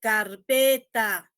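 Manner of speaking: "carpeta" lenta, con PE fuerte; acento tónico marcado. Shown as a demonstration. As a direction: stretch and punch PE in "carpeta". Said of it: "una sílaba que resalte de las demás". ("carpeta" despacio, resaltando PE)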